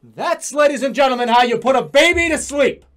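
announcer voice. Pretend. Mock announcement to audience.

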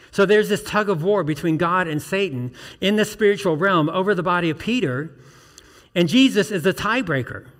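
high, loud, rising preaching; preaching at full force. Real, not acted. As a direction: build the sermon to a loud climax.

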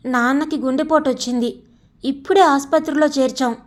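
plain reading voice

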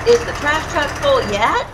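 ordinary delivery, nothing marked

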